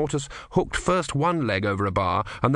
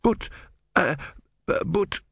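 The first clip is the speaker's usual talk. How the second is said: higher, halting nervous stutter; nervous stammer; 8 semitones higher than usual. Acted. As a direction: trip over the words in panic. Brother stammering at the news.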